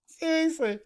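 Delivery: high-pitched word squeezed out after laughing; helpless laughter. Real, not acted. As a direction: laugh so hard the word squeaks. Laughing at the replayed clip.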